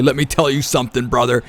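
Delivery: a quick, light mock Hulk Hogan catchphrase; Hulk Hogan line. Caster quotes Hulk Hogan about Prioxis, a light mock wrestler voice. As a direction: toss off a hint of the wrestler's bravado. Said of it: "as Hulk Hogan would say".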